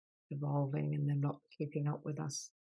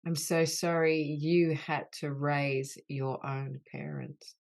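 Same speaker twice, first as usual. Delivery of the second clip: healing litany; 4 dB louder than usual. Acted. Leader voicing apologies to the wounded inner child, earnest and grave. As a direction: lead the group through each sorry with tender gravity.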